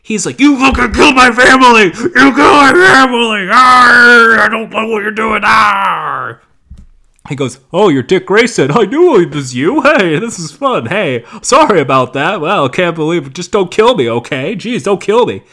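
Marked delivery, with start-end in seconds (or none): 0.4-6.4 hammed-up roaring, high and very loud; enraged mock screaming. Hero attacking his family's killer, hammed-up screaming rage. 7.7-15.4 hammed-up nervous babble, louder and jumping in pitch; pleading mobster. Mobster recognizing his captor, mock chummy pleading.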